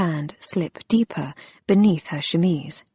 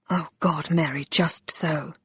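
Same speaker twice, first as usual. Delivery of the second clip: hoarse, hushed and raw; hoarse whisper; 5 dB quieter than usual. Acted. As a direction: rasp it out under your breath. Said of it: "he said hoarsely".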